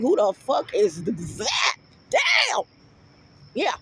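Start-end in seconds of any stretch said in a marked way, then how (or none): 0.0-2.6 mock amazement. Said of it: "everybody gonna shut up like".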